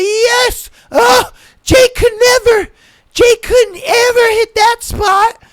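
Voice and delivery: very high, loud hammed-up screams of pleasure; mock screaming girlfriend. Girlfriend taunting Jake mid-affair, hammed-up shrieks. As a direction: shriek it like a parody of passion. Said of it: "she's over here just like".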